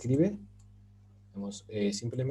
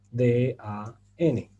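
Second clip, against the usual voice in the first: spelled out slowly; 5 dB louder, much slower than usual. Shown as a demonstration. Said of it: "lo encontramos de esta forma".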